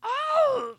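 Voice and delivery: loud high cry; Wilhelm scream. Acted. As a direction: cry out as if hit. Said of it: "Jose, go".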